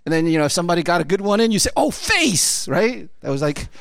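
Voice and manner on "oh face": high-pitched mock schoolyard jeer; imitated taunt. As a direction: crow it like a kid reacting to a burn. Mimics kids' taunts on bus.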